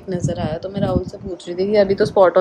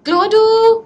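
calling the dog; 13 semitones higher, slower than usual. Real, not acted. Owner calling Chloe from across the house, high call. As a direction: sing out her name to fetch her.

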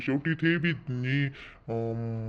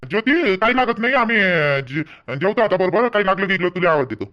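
loud, firm brushing off; loud dismissal; 4 semitones higher, 7 dB louder than usual. Acted. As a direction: shoo her away loudly. Loudly sending Jyoti away. (मोठ्याने हाकलणे)

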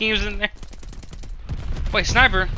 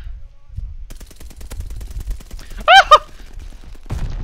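long, high-pitched giggling fit; real drawn-out laughter. Real, not acted. Laughing during the game.

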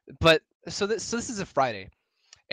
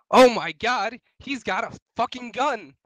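shrill alarmed shriek, much higher and louder; panicked teacher; 9 semitones higher, 7 dB louder than usual. Retold or imitated. Teacher shrieking in alarm at a shotgun shell. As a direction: cry out like you've spotted a gunman. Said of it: "She's like".

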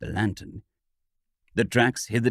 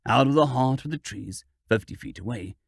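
ordinary delivery, nothing marked

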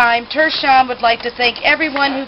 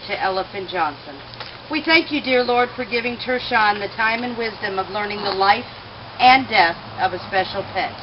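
graveside prayer; 3 semitones lower, 4 dB quieter than usual. Acted. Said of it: "Let us pray".

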